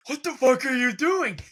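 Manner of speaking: imitated Rodgers meltdown; imitating a freak-out. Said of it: "freaking the fuck out like a baby".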